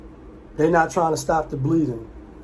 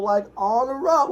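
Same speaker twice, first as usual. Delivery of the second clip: excited recall; 7 semitones higher than usual. Real, not acted. Repeating the movie title.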